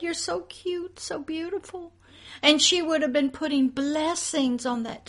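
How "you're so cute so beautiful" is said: sweet mother. Retold or imitated. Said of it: "She could have said".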